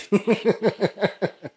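long rippling laugh; laughter. Real, not acted. Listener amused that he keeps talking, laughing freely.

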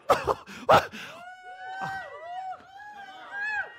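high wailing laugh-cry; sobbing laugh; overwhelmed laughing cry. Retold or imitated. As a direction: break down in joy. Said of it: "I'd be like".

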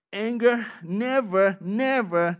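slow, loud stress on each "never"; emphatic never. Acted. Preacher warning the congregation, slow loud stress on "never". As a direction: drag out "never" and hit it hard each time.

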